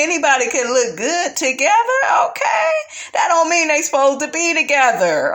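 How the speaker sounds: high, shouting rant at the cast; heated rant. Real, not acted. Yelling at Kimmy about looking good together. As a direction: yell it out, fed up.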